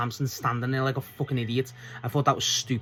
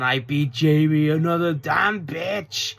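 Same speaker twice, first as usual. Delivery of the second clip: slow, louder mock promo boast; Tekla; 4 semitones higher, 5 dB louder, much slower than usual. Fan mimics a wrestler bragging, drawn-out impression. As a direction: stretch it out, building to a hammed-up finish. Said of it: "she's just going".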